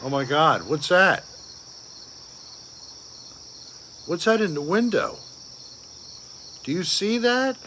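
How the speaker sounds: rising pitch, quick startled questions; suddenly spot something odd in the window; startled alarm. Real, not acted.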